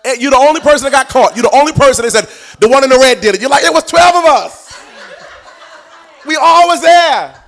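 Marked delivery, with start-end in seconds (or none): 0.0-3.4 louder, higher, driving preaching build; preaching shout. Real, not acted. 3.6-7.4 much higher, laughing mock protest, slowed at the end. Protesting believer voiced laughing.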